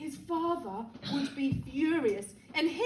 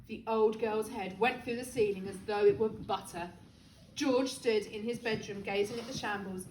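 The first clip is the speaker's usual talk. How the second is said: ordinary delivery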